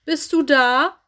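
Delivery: loud, high questioning call. A narrator's reading. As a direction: call through the house to see who is home. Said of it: "rief er". (laut und fragend rufend)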